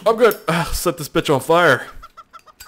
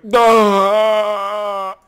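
loud, higher, drawn-out dopey "duh"; hammed-up dim-witted groan; mock dopey voice; 8 semitones higher, 5 dB louder than usual. Imitating the figure he looks at, mock dopey voice. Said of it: "He's like".

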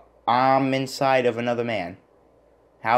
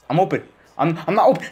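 higher, louder whimper; whiny near-cry; 7 semitones higher, 6 dB louder, faster than usual. Real, not acted. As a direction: whine it like you're about to cry. Realizes he's covered.

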